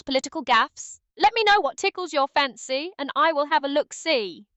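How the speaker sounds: lightly put-on British accent, cheeky; a hint of a cheery British accent. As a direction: play it as a jokey Brit. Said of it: "can British [name] come out".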